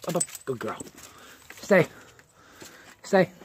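usual speaking voice

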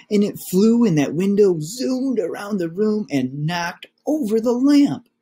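little cubs spinning their tall tale; excited cubs. Acted. Cubs tell whopper.

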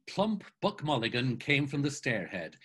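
ordinary talk, nothing marked

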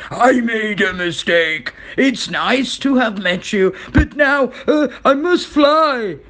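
flustered dragon building to a high cry; shaken dragon. A narrator's reading. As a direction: splutter excuses in mounting panic. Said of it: "he started to shake".

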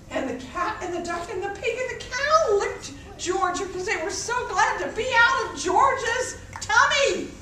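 ordinary delivery, nothing marked

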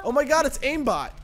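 loud, high disbelief; astonished high exclamation; amazed outcry. Real, not acted. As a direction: cry out at what you just saw. Outcry at game replay.

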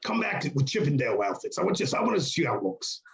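lightly voiced boss impression; Vince impression. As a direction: give orders in a put-on boss voice. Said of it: "That's a great Vince impression".

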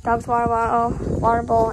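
ordinary delivery

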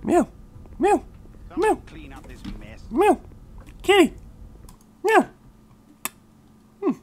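tiny high-pitched kitten mews; cat meowing. Pretend. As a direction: squeak out short, high meows. Meows at his cat.

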